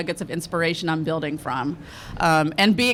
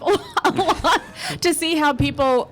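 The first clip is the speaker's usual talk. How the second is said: high-pitched, laughing through the words; laughing confession; 7 semitones higher than usual. Real, not acted. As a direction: own up to it while laughing. Amused confession of eavesdropping.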